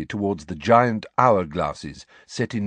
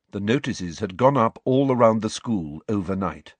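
ordinary delivery

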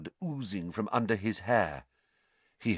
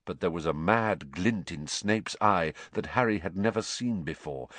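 plain reading voice

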